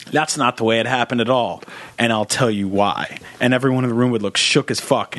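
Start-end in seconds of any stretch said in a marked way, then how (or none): 0.1-3.2 smug detective. Retold or imitated. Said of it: "she'd be like".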